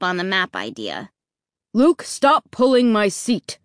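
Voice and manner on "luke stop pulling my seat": irritated dad snapping. A narrator's reading. Dad scolds son tugging his seat, snapping. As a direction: snap it out, a touch raised. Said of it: "he snapped".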